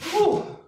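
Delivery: high breathy exhale of relief; high relieved whew. Real, not acted. Relief after workout.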